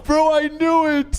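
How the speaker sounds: high-pitched "called it" excitement; excited outburst. Real, not acted. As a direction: burst out high, vindicated. Reacting to the revenge story reveal.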